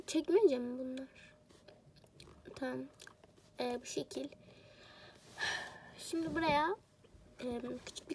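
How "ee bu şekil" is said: ordinary talk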